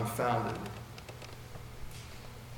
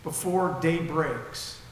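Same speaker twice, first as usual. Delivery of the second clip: Jesus speaking firmly; 2 semitones higher, slower than usual. Acted. Jesus to Peter, slow and solemn. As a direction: lay down each word slowly as Jesus. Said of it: "He looks at him and says".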